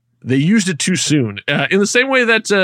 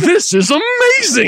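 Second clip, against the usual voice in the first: slow, much higher, gushing; retold delight; 13 semitones higher, slower than usual. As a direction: awestruck fan gasp. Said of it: "And I'm like".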